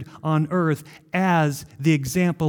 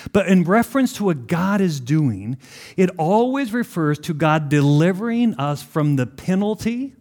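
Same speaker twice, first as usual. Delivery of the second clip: normal talking voice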